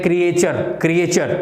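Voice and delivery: "क्रिएचर" as kri-AY-chur, three syllables; "क्रिएचर" the wrong way, kri-ay-char; common mispronunciation. Shown as a demonstration. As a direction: sound an "ay" inside "क्रिएचर". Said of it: "ज़्यादातर ऐसा सुनते हैं".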